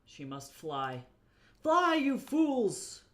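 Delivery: loud, high, slow wizard cry; epic wizard shout. Pretend. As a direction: bellow it like a wizard. Quoting Gandalf while writing.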